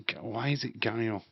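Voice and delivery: ordinary talk, nothing marked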